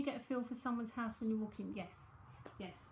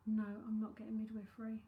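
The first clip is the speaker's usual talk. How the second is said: normal talking voice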